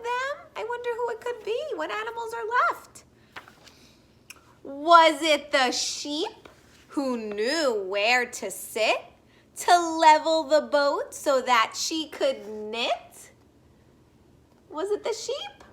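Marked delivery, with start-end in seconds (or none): 4.6-13.3 slow, sing-song picture-book read-aloud; gentle storybook voice for little listeners; reading to children. Acted. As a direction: read it like story time on the rug.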